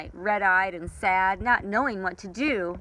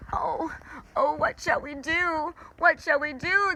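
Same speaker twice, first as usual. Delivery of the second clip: higher, whimpering cry of fear; moaning; 9 semitones higher than usual. Acted. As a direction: moan helplessly as the moon vanishes. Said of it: "the night creatures moaned".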